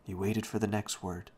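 plain reading voice

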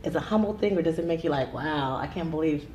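ordinary talk, nothing marked

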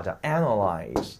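analyze said slowly with the stress on the first syllable. Shown as a demonstration. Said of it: "重音在最前面". (analyze慢读，重音放在第一个音节)

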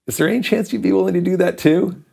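high, sheepish retold plea; cringing request. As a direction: ask gingerly, braced for a no. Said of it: "I'm cringing the whole time".

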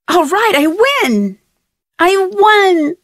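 high, gleeful victory cry; excited cheer. Real, not acted. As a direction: crow about winning. Winning a puzzle game.

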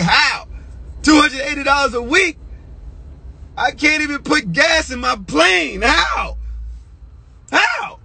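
very high, strained disbelief; incredulous outcry. Retold or imitated. Underpaid rookie questioning the weekly pay, incredulous outcry.

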